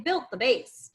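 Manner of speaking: ordinary talk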